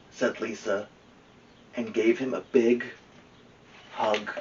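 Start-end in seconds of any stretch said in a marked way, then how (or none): none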